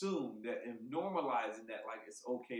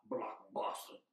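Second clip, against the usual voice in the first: mock whisper; 3 semitones lower, slower than usual. Mocking the misheard slur.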